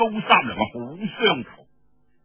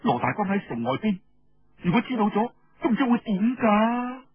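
higher voice, rising with worry; worried young woman; 5 semitones higher than usual. Acted. Hong Xuanjiao telling Feng about Su Sanniang, anxious and high. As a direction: pour out the worry, pitch climbing.

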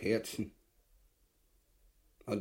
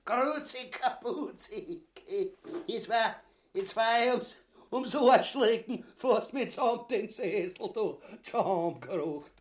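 high, giggly chatter ending in laughter; amused high-pitched babble; giggling; 6 semitones higher than usual. Acted. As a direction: tell it high, breaking into a laugh. Laughs over nearly breaking the chair.

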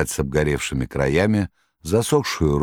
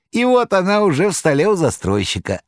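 much higher jester voice; Koroviev; 14 semitones higher, 4 dB louder than usual. Acted. Koroviev reassuring the master, high clownish voice. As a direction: jeer like a clownish trickster.

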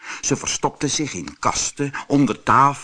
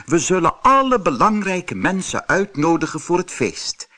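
higher, louder queen voice; light royal lady voice; 7 semitones higher, 4 dB louder than usual. Acted. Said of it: "zei de koningin".

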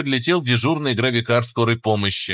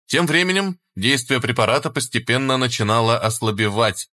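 ordinary delivery, nothing marked

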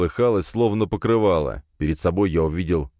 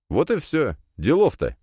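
pitched-up, easy self-satisfaction; smug grin; 9 semitones higher than usual. A narrator's reading. Hero after an easy magic trick, smug. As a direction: toss it off with a grin. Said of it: "ухмыльнулся я".

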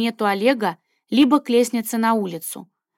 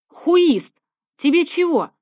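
sharp shout; 7 semitones higher, 4 dB louder, slower than usual. Acted. Snapping at startled waiter.

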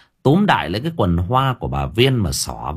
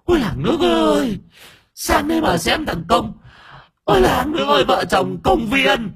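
wailing possessed crone. A narrator's reading. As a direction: cry out to the whole village. Old woman wails to village.

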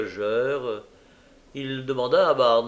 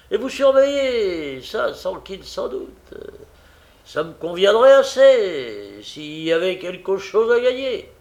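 eager scheming; 4 semitones higher, 8 dB louder than usual. A narrator's reading. Noah scheming aloud.

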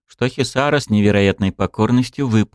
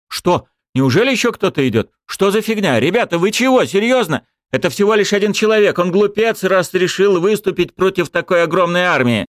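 agitated soldiers; 10 semitones higher, 3 dB louder than usual. Acted. Soldiers shocked and jeering.

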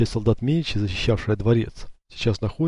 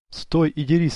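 higher-pitched challenge to fight; defiant challenge; 7 semitones higher than usual. A narrator's reading. Эцио to his fleeing enemy Франческо, defiant challenge.

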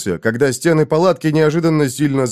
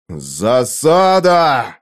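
very drawn-out cry of alarm; shouted; 3 semitones lower, much slower than usual. A narrator's reading. Conan cries out trying to rise, shouting. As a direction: stretch the warning into a long cry. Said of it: "закричал Конан".

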